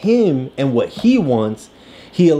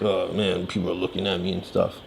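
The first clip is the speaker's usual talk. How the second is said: soft fast embarrassed mutter; quiet mumble; 7 semitones lower, 9 dB quieter, faster than usual. Retold or imitated. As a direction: mumble it quickly to yourself. Quoting embarrassed boy.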